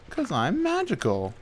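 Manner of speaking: high, breathy-free silly whimsical voice; silly magical voice. Pretend. Playful magical voice while explaining uploads. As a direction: put on a goofy fairy-tale voice. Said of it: "Why did I use this voice?".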